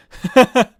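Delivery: sudden high burst of laughter; loud laugh. Real, not acted. Laughs at forgotten Fumo.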